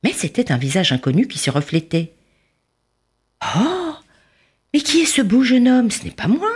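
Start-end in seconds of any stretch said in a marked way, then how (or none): 3.6-6.5 high, wondering disbelief; amazed. A narrator's reading. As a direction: marvel at a stranger's face. Li amazed at his reflection.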